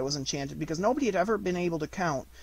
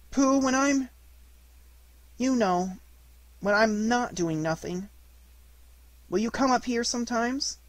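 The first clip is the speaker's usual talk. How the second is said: put-on higher boy voice, halting; hesitant Christopher Robin; 7 semitones higher than usual. Boy asking his bear to visit, hesitant and high. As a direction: feel for the words with long pauses, tentative.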